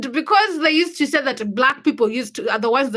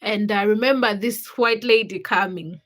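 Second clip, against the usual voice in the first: ordinary delivery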